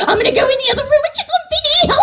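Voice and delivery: high, rushed maid squeal; klutzy Mei Rin. Acted. Maid chattering to herself off screen, flustered. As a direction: chirp it fast in a squeaky voice. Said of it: "she'd be off screen and be like".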